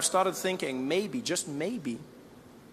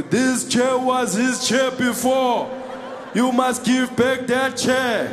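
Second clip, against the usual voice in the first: slow, high, booming political-rally delivery; Julius fighting for you; 5 semitones higher, much slower than usual. Acted. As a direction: declaim each word as a drawn-out demand. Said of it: "He would be there fighting for you".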